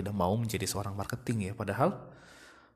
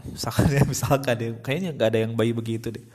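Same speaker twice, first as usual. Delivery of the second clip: ordinary delivery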